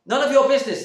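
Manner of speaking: high, loud snap; sharp retort. Retold or imitated. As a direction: fling it back at him, indignant. Narrator snaps back at lodger.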